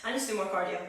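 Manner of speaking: usual speaking voice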